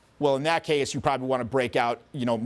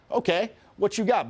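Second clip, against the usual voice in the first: higher, playful dare; game challenge; 6 semitones higher than usual. Acted. Person answering a clapback, playful challenge. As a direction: invite the comeback.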